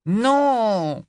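high, loud desperate cry. Acted. Boy cries out as the girl walks away, loud. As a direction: call her back, urgent. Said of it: "s'écria Rogue".

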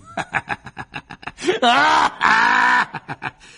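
loud high crazed laughter; maniacal laugh. Acted. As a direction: cackle like a madman. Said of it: "avec un rire de fou furieux".

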